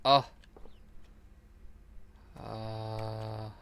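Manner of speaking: ordinary talk, nothing marked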